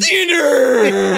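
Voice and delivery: high, loud mock witch curse; shrieked curse. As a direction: screech the curse.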